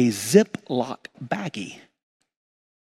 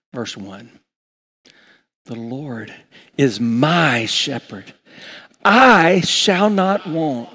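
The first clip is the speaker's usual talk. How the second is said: slow, measured reading of the psalm; psalm recitation; slower than usual. Acted. Pastor leading the congregation in Psalm twenty-three, slow. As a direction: recite each phrase deliberately.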